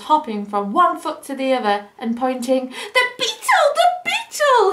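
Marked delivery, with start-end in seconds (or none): 2.7-4.7 very high squeak; squeaky hedgehog. Acted. Hedgehog spots the beetle and squeaks. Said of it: "he squeaked".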